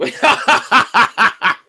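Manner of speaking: high, loud helpless laugh; loud fan laughing at a blunder; burst of laughter. Real, not acted. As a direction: crack up out loud. Laughing at a slip.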